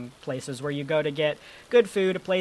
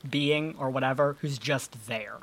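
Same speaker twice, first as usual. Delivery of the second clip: normal talking voice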